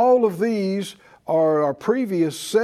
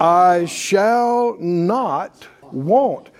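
drawn out, each word weighed; slow emphatic quote; much slower than usual. Shown as a demonstration. Preacher coaxing the congregation to finish the verse, slow and emphatic. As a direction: let every word of it land slowly. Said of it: "Help me out".

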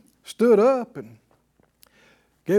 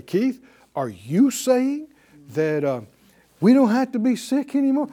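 incredulous pastor; 6 semitones higher, 4 dB louder than usual. Retold or imitated. Replaying the pastor's challenge to him, mildly incredulous. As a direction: imitate the pastor's disbelieving challenge. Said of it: "I heard the pastor say".

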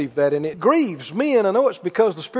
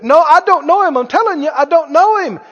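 higher-pitched, emphatic acted denial; insistent denial; 6 semitones higher, 4 dB louder than usual. Peter answering his accusers out by the fire, insistently denying. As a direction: deny it firmly, voice pushed up.

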